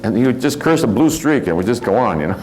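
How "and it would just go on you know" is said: normal talking voice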